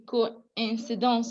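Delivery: "coincidence" slowly, starting ko-in, not kwa; O and I separated. Shown as a demonstration. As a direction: draw out "coincidence" with O and I sounded apart. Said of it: "So we did not say qua".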